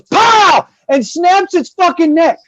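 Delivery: sudden high, loud burst; explosive exclamation acting out a blow; acted impact shout. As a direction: shout it as a comic sound effect. Acting out sudden neck snap.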